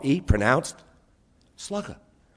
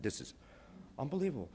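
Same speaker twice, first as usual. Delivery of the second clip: awed hush; 17 dB quieter, faster than usual. Retold or imitated. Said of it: "I said".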